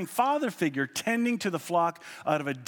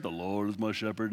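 low, flat droning graveside reading; boring monotone minister; 6 semitones lower, 4 dB quieter than usual. Retold or imitated. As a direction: drone it like a bored minister at a funeral. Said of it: "the guy has a monotone voice".

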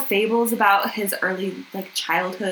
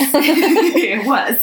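laughing through it; 6 semitones higher, 7 dB louder than usual. Real, not acted. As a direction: say it while laughing. Laughing agreement.

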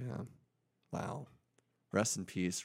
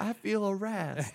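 mock victim; 10 semitones higher, slower than usual.

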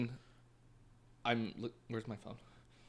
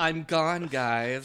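imitating her loud dramatic farewell; loud mock farewell from a dramatic friend; 5 semitones higher, 10 dB louder, much slower than usual. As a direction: bellow the goodbye like a drama queen. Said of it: "She's like".

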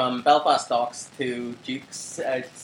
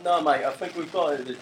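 London accent, attempted; 3 semitones higher than usual. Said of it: "he's going like".

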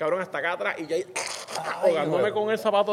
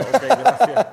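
higher and much louder, comic; mock chastened reply; 7 semitones higher, 10 dB louder, much slower than usual. Answering the doctor's diagnosis with a mock sheepish thanks. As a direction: fire back an embarrassed thanks.

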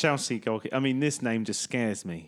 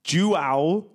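slow, exaggerated Portuguese João Moutinho; Portuguese accent; much slower than usual. Shown as a demonstration. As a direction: overdo the Portuguese, drawing out Moutinho. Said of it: "No, it's Portuguese".

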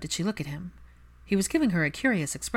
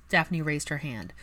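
ordinary delivery

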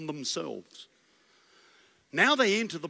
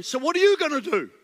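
higher, louder jeering question; skeptical challenge; 9 semitones higher, 5 dB louder, faster than usual. Acted. People questioning Joshua's plan, mock jeer. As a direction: challenge him like a doubter.

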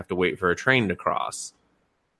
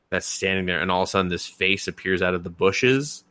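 ordinary talk, nothing marked

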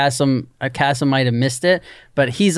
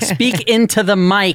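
slow, higher, overly careful reminder; mock instruction; 6 semitones higher, slower than usual. Teasing the guest, a slow high mock instruction. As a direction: spell it out slowly like a fussy producer. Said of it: "He's like".

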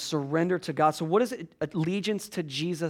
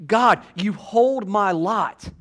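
"God, you hold my lot" drawn out slowly and louder; slow proclaiming; 4 semitones higher, 8 dB louder, much slower than usual. Acted. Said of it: "he goes on to say".

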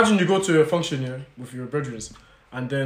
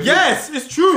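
emphatic agreement; 12 semitones higher, 4 dB louder, much slower than usual. Real, not acted. Heated argument over TV show.

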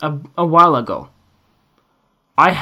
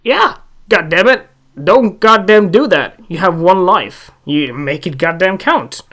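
louder and higher, swearing hard; heated outburst; 4 semitones higher, 6 dB louder than usual. Real, not acted. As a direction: let it burst out, fired up. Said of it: "I'm going super hard here".

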